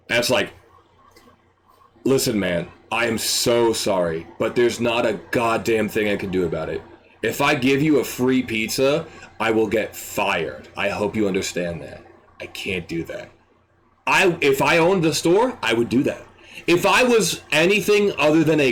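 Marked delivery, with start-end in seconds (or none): 2.0-13.3 regretful, lowered voice; sincere apology. Acted. Worker apologizing to customer who dropped pizza.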